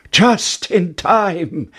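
high, stretched-out exclamation; drawn-out, high storybook cheer; triumphant cry. Acted. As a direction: cry out slowly in relief. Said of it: "cried Jack".

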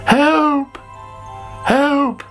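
high panicked cries; calling for help. A narrator's reading. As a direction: cry out for help, scared. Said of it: "called Sammy".

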